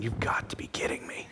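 unvoiced whispered disbelief. Thinking to himself in dismay mid-conversation with Eleanor, whispered. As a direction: breathe it out in a secret whisper. Thought in head facing Eleanor.

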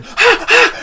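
two very high, loud yelps; short panicked screams, played for laughs; reenacted scream. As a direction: shriek as if something flew at you. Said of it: "I fucking screamed".

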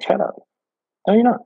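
high squeaky disbelief; incredulous. Retold or imitated. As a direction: refuse to believe it, rising. Kid still denying, pitch rising.

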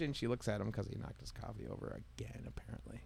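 ordinary delivery

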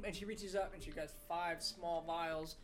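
ordinary delivery

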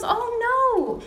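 high, drawn-out dismay; acted-out "oops" moment, pitched high; retold realization. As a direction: imitate someone realizing a silly mix-up. Daughter-in-law realizes the mix-up.

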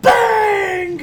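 very high, loud mock commentator shout; announcer shot call. Fan imitates his favorite announcer as they shoot, high shout. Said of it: "they shoot and he goes".

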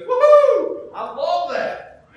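high, loud, slowed joyful cheer; ecstatic whoop. Retold or imitated. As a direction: cheer like a man lost in worship. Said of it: "He goes".

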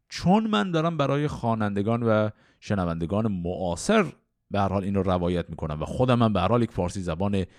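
ordinary delivery

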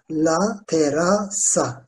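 "La terasa" slowly, split into syllables. Shown as a demonstration. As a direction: spell out "La terasa" by sound, piece by piece. Teacher breaks the word into syllables. ("La terasa" sözünü yavaşça hecele)

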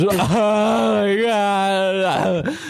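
a slow, high, drawn-out "Oh my God". Retold or imitated. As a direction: stretch it out slowly, high and overwhelmed. Overwhelmed after bawling in the car.

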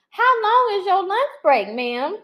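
high, loud, playful call-out; mock confrontation. Calling out a remote worker seen out shopping, mock-accusing. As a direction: challenge them in mock disbelief, laughter creeping in.

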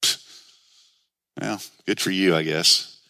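low, dismissive scoff; puff, then brush them off drily; mocking tone. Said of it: "have a mocking tone".

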